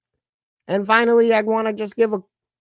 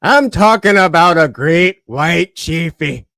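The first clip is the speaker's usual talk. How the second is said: loud, low shark hunter impression; forceful old sea-dog warning; Quint; 5 semitones lower, 8 dB louder than usual. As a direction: bellow it low, easing on the last word. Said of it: "my best impersonation of Quint".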